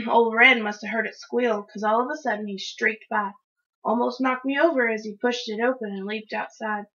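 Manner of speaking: usual speaking voice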